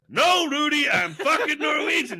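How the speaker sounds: high, loud indignant yell; mock furious shout. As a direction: shout it like an offended Norwegian.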